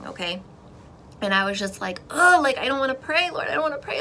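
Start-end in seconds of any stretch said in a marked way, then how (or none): 2.1-4.0 higher-pitched, exasperated protest; reluctant whine. Retold or imitated. Believer balking at prayer to the Lord, reluctant whine. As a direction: groan out a retold reluctance.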